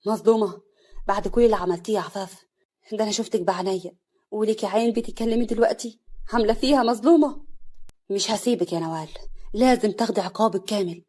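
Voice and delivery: loud furious rebuke; angry. Acted. Husband rebuking Nawal, loud and angry. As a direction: hurl her words back at her. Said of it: "حسن بغضب".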